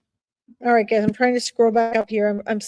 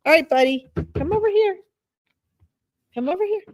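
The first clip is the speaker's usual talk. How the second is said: coaxing pet voice; 6 semitones higher, faster than usual. Real, not acted. Coaxing her cat over.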